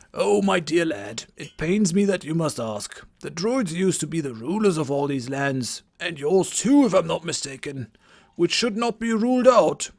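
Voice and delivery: put-on a theatrical old druid greeting; old wizard voice. Game master voicing Merlin greeting the Vikings, old wizard voice. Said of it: "the voice that I'm giving him".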